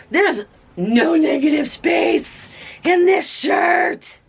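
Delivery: loud, high, slowing near the end; excited shout. Real, not acted. Thrilled to show viewers the vibrant shirt, shouting. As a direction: belt it out in delight.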